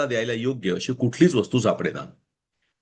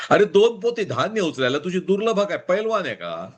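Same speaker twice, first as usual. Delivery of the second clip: high, teasing ribbing of a friend; lightly mock a naive friend; 7 semitones higher than usual. A narrator's reading. Friend mocking Pula's odd gift idea, teasing. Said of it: "अय्यप्पानं त्याची खिल्ली उडवली".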